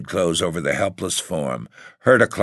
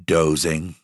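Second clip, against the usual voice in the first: flat, cold one-word answer; stony. A narrator's reading. Ethel lying to her sister, stonily. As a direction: shut her down coldly. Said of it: "Ethel said, stonily".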